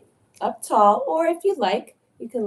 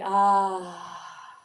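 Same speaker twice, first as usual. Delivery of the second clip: long relaxed sigh out. Shown as a demonstration. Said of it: "Let's sigh it out".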